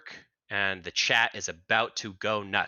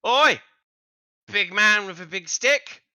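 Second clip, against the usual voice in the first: put-on loud, high accented shout; high, put-on character holler; big guy; 8 semitones higher, 6 dB louder, slower than usual. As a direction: call out roughly as the big dude. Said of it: "And this guy says".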